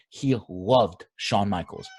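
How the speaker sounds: slow down and lean on "loved"; "loved" stretched out for emphasis; stress on LOVED. Real, not acted. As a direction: put the weight on "loved", drawn out. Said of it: "Emphasis on loved".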